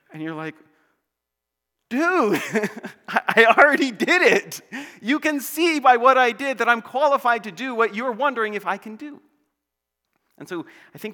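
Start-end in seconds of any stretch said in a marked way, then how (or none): 1.9-9.2 high, loud, laughing protest; incredulous comeback, raised voice; exasperated retort. Acted. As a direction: protest loudly through a laugh, pitched way up. Acted exasperated reply to qualification question.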